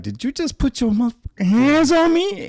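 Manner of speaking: high, loud threatening yell; furious confrontation. Retold or imitated. Comic confronting the owner who grabbed him, furious yell. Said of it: "I was still like".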